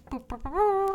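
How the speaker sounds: seahorse trumpet noise. Retold or imitated. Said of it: "And he's like".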